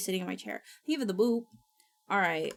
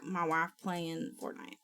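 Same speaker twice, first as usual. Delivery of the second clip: ordinary talk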